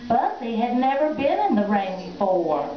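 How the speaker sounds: ordinary delivery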